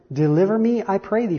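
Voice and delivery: a preacher lifting the prayer loudly; fervent cry. Acted. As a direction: cry the plea out to God. Fervent plea read from scripture.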